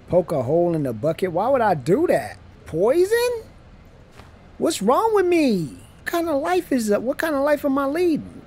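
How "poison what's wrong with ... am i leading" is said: shocked outburst. Real, not acted. Gamer told to poison a coworker, high shocked protest. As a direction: recoil at the game's request. Game asks him to poison.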